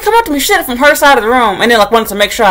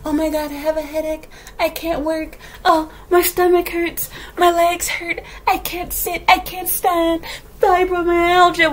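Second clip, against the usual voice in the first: high, soft, slow complaining voice; mimicking a coworker's sick-day excuses, a high mocking whine; mock whiny coworker; 8 semitones higher, 5 dB quieter, slower than usual. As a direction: whine about every ache like a hypochondriac. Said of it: "Every day".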